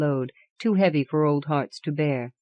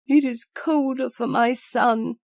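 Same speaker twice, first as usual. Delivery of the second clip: high, slow tearful words through weeping; a mother weeping for her dead son; 8 semitones higher, slower than usual. A narrator's reading. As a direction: grieve for the lost son, voice wet with tears. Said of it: "and wept afresh".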